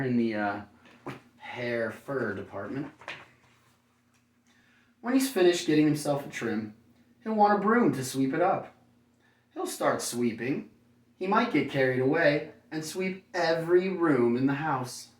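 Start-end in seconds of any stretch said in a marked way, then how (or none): 5.0-15.1 bright, lively storybook read, higher and louder; reading to children. A narrator's reading. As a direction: read it like bedtime story to little kids. Reads picture book to children.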